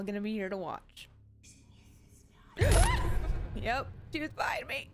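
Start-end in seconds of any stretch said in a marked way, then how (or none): 2.6-3.6 loud high gasp of fright; sharp startled gasp. Real, not acted. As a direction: caught off guard by the killer. Killer appears behind her.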